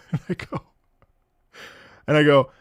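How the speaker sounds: usual speaking voice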